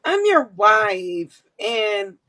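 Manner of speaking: slow, drawn-out mock pleading; begging. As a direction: beg like a pleading wife. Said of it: "do she be in that motherfucker begging".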